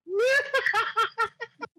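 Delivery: high burst of laughter; loud laugh. Real, not acted. Laugh out of character.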